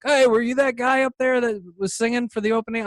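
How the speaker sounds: imitating him, high and loud; retold musician's question. Musician asking the young singer about his set, imitated call. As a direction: call it out as the other guy, pitch held high.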